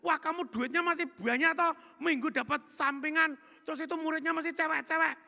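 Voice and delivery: loud, high-pitched ribbing; teasing. Retold or imitated. Boss teasing his tailor about his Sunday pupils, loud and high. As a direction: poke fun at him, loud and high. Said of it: "ledekin ya".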